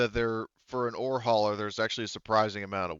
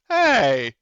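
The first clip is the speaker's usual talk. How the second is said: loud, higher indignant "Hey!"; mock offended yell; 10 semitones higher, 13 dB louder than usual. GM voicing an insulted synthetic crew member, mock offended yell. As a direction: bark it like an insulted robot.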